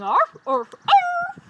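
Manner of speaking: loud, high, excited arfs; dog bark. A narrator's reading. Storyteller voices the dog singing, excited barking. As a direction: yap out, rising to a high last bark. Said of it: "And the dog sang".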